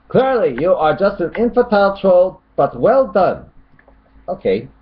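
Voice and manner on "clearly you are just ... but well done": high, raised reading of a commenter; mock commenter voice. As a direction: put on a high voice for the critic. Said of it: "Tony Stokes says".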